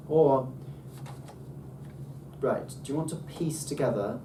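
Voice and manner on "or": OR pushed louder with a glide; up-and-down pitch accent. Shown as a demonstration. Teacher shows the squiggle once more, up-and-down glide. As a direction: bend OR up and back down. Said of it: "go up and down".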